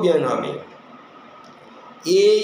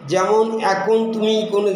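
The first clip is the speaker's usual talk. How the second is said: normal talking voice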